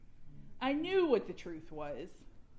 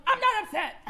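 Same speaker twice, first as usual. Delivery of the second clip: loud, high, laughing denial; mock indignant yell; 11 semitones higher, 9 dB louder than usual. Answering her husband with a laughing, too-loud denial. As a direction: insist you're not upset while clearly upset. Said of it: "And I'm like".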